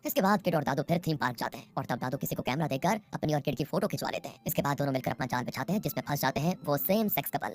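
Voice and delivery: usual speaking voice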